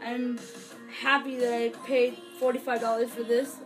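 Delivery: ordinary talk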